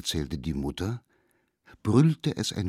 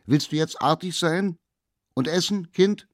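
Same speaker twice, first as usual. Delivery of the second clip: laut und viel höher, steigend bis zum Kind; rufende Mutter; 11 semitones higher, 7 dB louder than usual. Acted. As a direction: ruf es drängend durch die Tür. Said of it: "rief durch die Tür". (Mutter ruft durch die Tür laut nach dem Kind)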